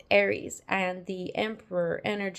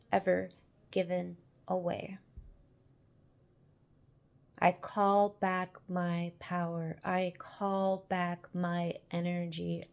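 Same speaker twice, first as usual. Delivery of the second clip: calling back power; 4 dB quieter than usual. Acted. Affirmation ritual, slow and soft.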